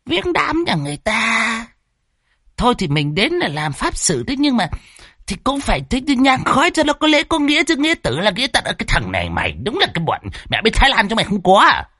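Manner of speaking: put-on high, comic old man grumbling; scolding old man. Old Đồng lecturing Phọt about funeral offerings, high and comic. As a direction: nag him in a squeaky old voice.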